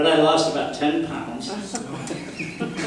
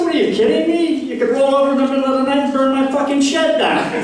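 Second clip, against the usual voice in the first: mock outrage; 10 semitones higher, faster than usual. Retorting to wife in story.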